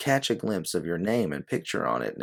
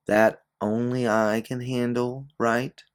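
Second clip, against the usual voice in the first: finishing her sentence; 7 semitones higher, 4 dB louder, slower than usual. Acted. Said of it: "You finish her sentence for her".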